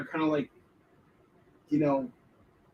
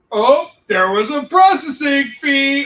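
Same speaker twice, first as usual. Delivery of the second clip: mock surprise; 7 semitones higher, 9 dB louder than usual. Mimicking a crowdfunding site springing hidden fees, loud high mockery.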